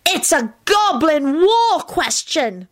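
high, drawn-out shriek. A narrator's reading. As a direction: cry it out high and slow, for drama. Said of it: "Teddy shrieked".

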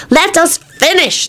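high, slow, firm; mildly stern boy; stern child. A narrator's reading. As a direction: insist like a kid in charge. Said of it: "told him sternly".

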